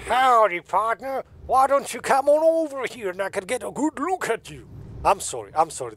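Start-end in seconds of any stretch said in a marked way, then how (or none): 0.1-4.7 hammed-up cowboy impersonation; Clyde the cowboy. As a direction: holler it like an over-the-top cowboy. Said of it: "Clyde is the cowboy cousin".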